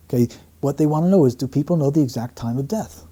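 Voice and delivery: normal talking voice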